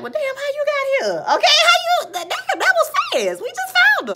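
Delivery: very high-pitched squeaky disbelief; mock astonishment. Retelling her shock at how fast he got here, high mock squeal. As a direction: squeal it as a hammed-up startled reaction. Retold shock at sudden arrival.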